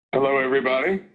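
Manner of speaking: ordinary talk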